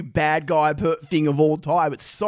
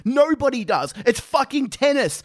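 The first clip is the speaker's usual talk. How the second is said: higher, heated comic tirade; mock rant; 6 semitones higher than usual. Real, not acted. Ranting to his co-host about tennis for laughs. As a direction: rail against tennis for laughs.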